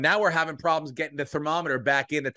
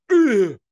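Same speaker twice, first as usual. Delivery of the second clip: a loud, high imitated grunt from a bored boy; mock teen grunt; 11 semitones higher, 10 dB louder than usual. Teen boys at a party grunting at each other, loud mock grunt. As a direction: grunt it out like a teenager with no words. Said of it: "The boys were just like".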